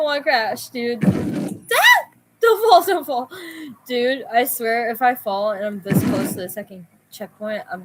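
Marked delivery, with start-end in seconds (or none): 1.0-2.1 high panicked shriek; panicked scream. Real, not acted. Car nearly falls. 2.4-3.3 panicked pleading. Real, not acted. Car nearly falls.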